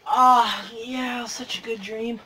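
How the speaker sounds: soft, dreamy murmur; contented half-awake sigh of words; waking from a dream. Acted. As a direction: trail off softly as if just waking. Acting someone waking from a dream.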